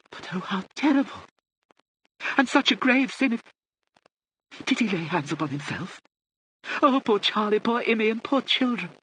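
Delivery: put-on very high, old-lady wail; distressed lament. A narrator's reading. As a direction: lament as a deaf aristocrat, pitched right up. Old lady laments supposed suicide.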